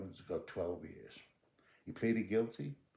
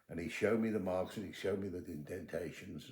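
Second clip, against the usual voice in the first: ordinary talk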